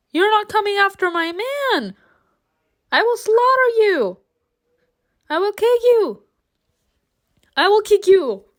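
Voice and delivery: high-pitched protective outrage, hammed-up; mock threats. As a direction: threaten him in a high squawk.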